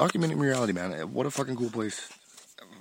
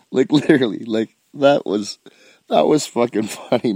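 laughing; 4 semitones higher, 9 dB louder than usual. Real, not acted. Cracking up recalling funny thread.

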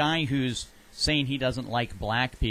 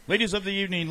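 higher mock-polite repeat; comic euphemism; 7 semitones higher, faster than usual. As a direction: say it like a prim euphemism. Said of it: "he has to say something like".

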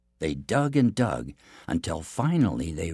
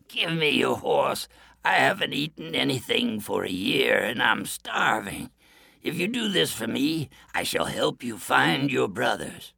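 weak wolf; 5 semitones higher than usual. A narrator's reading. Starving wolf begs the prince for his horse, weak and pleading. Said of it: "It was so weak and thin".

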